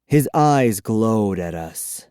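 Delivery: ordinary delivery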